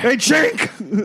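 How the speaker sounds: high mock call to a comrade. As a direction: holler across the battlefield. Acted calling out to soldier.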